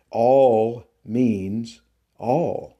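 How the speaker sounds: all means all, each word slow and weighted; emphatic slow. Acted. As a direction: lean on every all, slowly. Insisting on the verse's meaning.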